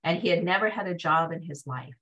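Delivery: usual speaking voice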